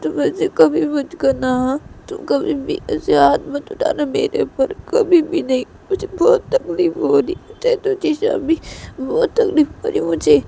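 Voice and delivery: high, loud, aching complaint; wounded loved one protesting loudly; hurt, pleading Shanaya. Acted.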